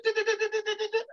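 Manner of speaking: very high rattling bionic sound effect; imitated bionic sound. Mimicking Steve Austin's bionic power sound for the host. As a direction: make the slow-mo bionic noise. Doing all the eyebrow and the.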